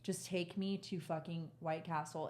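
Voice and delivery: soft, slow begging; worn-out, low-energy plea; retold weary plea. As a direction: plead quietly, word by word.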